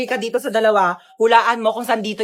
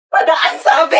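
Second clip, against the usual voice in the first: raised, impatient cry to let you pass; insistent shout; 4 semitones higher, slower than usual. Acted. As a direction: shout it, demanding to get through. Demanding to pass, fed up.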